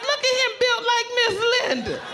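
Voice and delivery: high laughing jab; laughing tease. Retold or imitated. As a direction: laugh through the words at him. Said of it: "I'm like".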